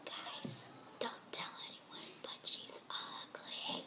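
unvoiced playful whisper. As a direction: whisper it like a secret. Secret aside to viewers.

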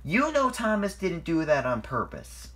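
mildly angry retort; indignant snap. A narrator's reading. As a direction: stick up for a friend crossly. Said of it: "snapped Edward".

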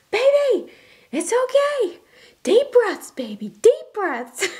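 put-on toddler shouting comfort. Toddler calling across the store to a crying baby, high and loud. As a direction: playfully mimic a two-year-old calling out reassurance. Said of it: "shouting across the aisles".

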